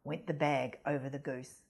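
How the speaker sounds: ordinary delivery, nothing marked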